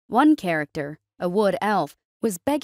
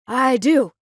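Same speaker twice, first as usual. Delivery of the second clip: quick, loud, eager answer; eager rushed reply; 4 semitones higher, 6 dB louder than usual. A narrator's reading. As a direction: blurt it out fast. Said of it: "Harrow rushed out".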